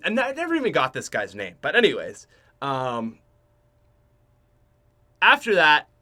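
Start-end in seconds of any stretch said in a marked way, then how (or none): none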